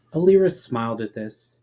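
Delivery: ordinary delivery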